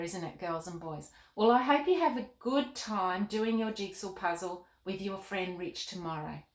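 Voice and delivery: usual speaking voice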